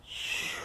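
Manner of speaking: soft, breath-only shushing whoosh; imitated whoosh. Shown as a demonstration. Man showing what stimulants do to his mind, hushed whoosh. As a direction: let out a hushed rush of air. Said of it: "It takes my mind and goes".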